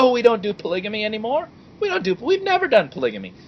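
"polygamy" in a high, fast sing-song; mockingly innocent; mock innocent denial. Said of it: "it's clear they went back".

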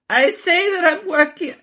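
quick high quote before the real voice; first try at the Melania line; high mock quote. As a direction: start the quote high, unprepared. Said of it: "wait, let me do my Melania voice".

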